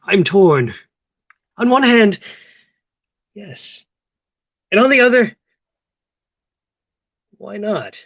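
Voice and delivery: high, loud mock dilemma; comically torn. [name] weighs giving in to Theo, hammed-up and loud. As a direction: swing between yes and yes.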